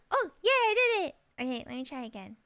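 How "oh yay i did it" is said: high, loud happy cheer; excited cheer. Real, not acted. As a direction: celebrate the win out loud. Succeeds at winking.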